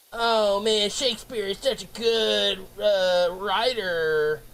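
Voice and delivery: slow, drawn-out mock praise; mocking admirer. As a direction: imitate a gushing fan, stretching the words. Mocking imitation of Shakespeare fans.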